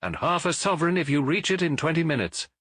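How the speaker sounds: high, eager call with a promise of money; lady urging her coachman on; urgent cry. A narrator's reading. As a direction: press the coachman to hurry. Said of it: "she cried".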